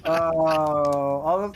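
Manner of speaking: normal talking voice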